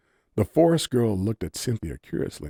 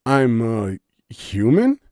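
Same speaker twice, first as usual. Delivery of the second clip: slow, louder, unsure question; confused; 5 semitones higher, 8 dB louder, slower than usual. A narrator's reading. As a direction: answer as if unsure of it herself. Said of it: "answered with confusion".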